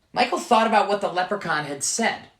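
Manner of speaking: usual speaking voice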